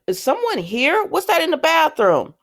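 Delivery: high-pitched mock interrogation; suspicious questioning. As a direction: act out a parole officer catching a noise. Voicing suspicious parole officer's questions.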